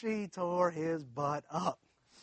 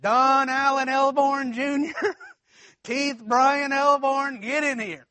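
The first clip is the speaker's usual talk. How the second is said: slow, louder and higher angry call across the house; mom calling out; 5 semitones higher, 4 dB louder, slower than usual. Retold or imitated. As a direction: bellow the boys' full names like a mad mother. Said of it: "we hear this voice".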